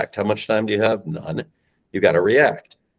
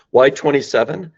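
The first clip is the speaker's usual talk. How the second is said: ordinary delivery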